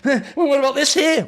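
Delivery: high-pitched, put-on huffy deflection; mock feminine huff. Host mimicking someone dodging what they're mad about, mock huffy. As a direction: imitate a sulker dodging the question, pitched right up. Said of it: "mad feminine coded thing".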